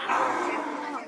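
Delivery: whispered pirate arr; breathy arr with the hand motion; pirate growl. Pretend. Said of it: "gotta do the hand motion with it".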